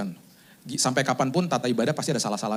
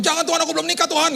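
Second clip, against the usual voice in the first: high-pitched mock pleading yell; retold shout; 12 semitones higher, 5 dB louder, faster than usual. Unmarried worshipper shouting from the back, high mock panic. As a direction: cry out in comic alarm. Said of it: "ada yang teriak di belakang".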